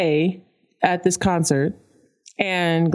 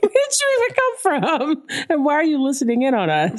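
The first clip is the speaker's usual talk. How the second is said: much higher, indignant mock outrage; incredulous mock voice; 8 semitones higher than usual. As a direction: play the baffled stranger, pitched way up. Mock quote of nosy strangers.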